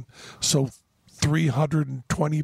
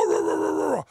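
higher, put-on rant of "rah"; a lofty mock bluster, pitched up; Stephen Fry's impression; 13 semitones higher, faster than usual. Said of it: "he goes".

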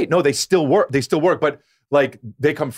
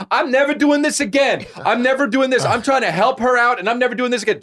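high-pitched, insistent mock complaint; exasperated; 9 semitones higher than usual. Friend fed up after trying to help, exasperated. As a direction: act out a fed-up protest, voice pushed up high. Kristen's exasperated refusal acted out.

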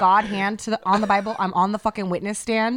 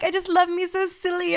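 high, cooing adoration; gushing woman; 10 semitones higher than usual. Retold or imitated. Counter woman cooing over the dog, high. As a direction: gush like you adore him. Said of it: "she's like".

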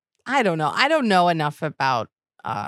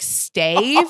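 rising puzzled "Stave?"; "Stave?" louder with rising tune; confused Stave; 3 semitones higher, 5 dB louder than usual. Retold or imitated. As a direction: echo the name back baffled. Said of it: "I was like".

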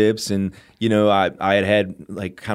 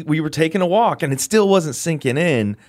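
usual speaking voice

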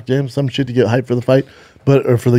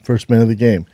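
ordinary talk

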